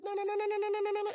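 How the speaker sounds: high, long chain of "no"; a hammed-up high-pitched cry; high wail. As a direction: wail it like a siren. Medallion calling out.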